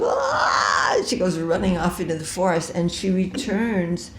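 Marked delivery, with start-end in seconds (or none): 0.0-1.0 scream of pain. Retold or imitated. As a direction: shriek as the nose is cut. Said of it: "And Shurpanaka goes".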